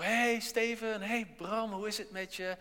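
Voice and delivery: mock-cheerful hail to an acquaintance; cheery greeting. Someone greets you in the street or church, cheerfully. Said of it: "heel vrolijk naar je toe".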